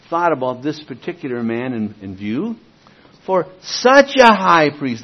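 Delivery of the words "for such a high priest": "such" leaned on, slow and loud; stress on such. Shown as a demonstration. Preacher reading Hebrews to the congregation, stressing "such". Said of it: "there's the word".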